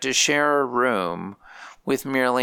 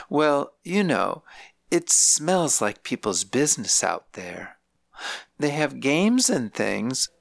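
lightly voiced elderly grandmother, pitched higher; grandmother's voice; 4 semitones higher than usual. Grandmother telling visiting family about nursing home life, higher lightly voiced. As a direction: lift the voice for an old woman complaining gently.

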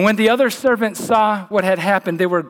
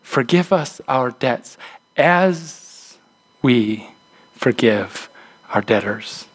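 prayer recitation; 7 semitones lower, slower than usual. Real, not acted.

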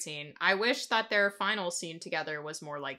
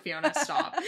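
laughing protest; 8 semitones higher than usual. Retold or imitated.

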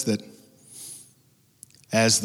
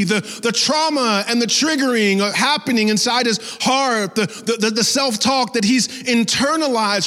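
higher, driving sermon voice, pressing each line; preaching at full force; 7 semitones higher than usual. Acted. Preacher presses the congregation about the inner critic, fervent and raised. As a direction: preach it out, building fervor.

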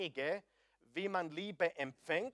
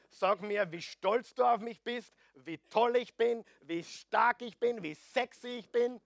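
playful demand; 5 semitones higher, 5 dB louder than usual. Pretend. Acting out his wish to wife.

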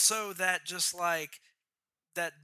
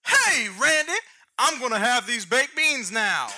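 higher, louder hammed-up actor impression; 5 semitones higher, 4 dB louder than usual. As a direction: announce the theft in a goofy voice. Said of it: "when [name] steals [name]'s baked beans".

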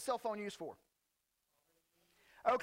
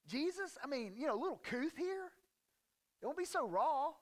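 high-pitched, rising, scandalized plea; mock protest; 7 semitones higher than usual. Taken-aback listener asking Jesus for a little couth, mock protest. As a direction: gently scold with a squeaky-high voice.

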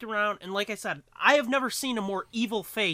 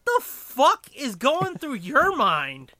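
incredulous retort; 6 semitones higher, 7 dB louder, slower than usual. Retold or imitated. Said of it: "And I was like".

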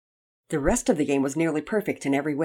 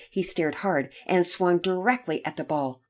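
ordinary delivery, nothing marked

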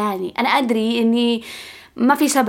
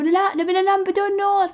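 acted protest; 10 semitones higher than usual. Sisters refusing to let her switch the lamp on, high mock protest. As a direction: imitate stubborn sisters refusing, pitched way up.

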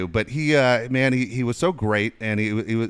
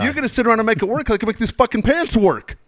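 much higher, louder and fast, driving it home; fast coach rant; 12 semitones higher, 4 dB louder than usual. Retold or imitated. Coach hammering his point home to the kids, fast and loud. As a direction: rattle it off like a fired-up coach.

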